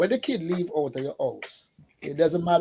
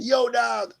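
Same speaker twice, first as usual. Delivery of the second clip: put-on excited teen; 4 semitones higher than usual. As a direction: hype it up like a teenager spreading news. Kids telling friends about custodian.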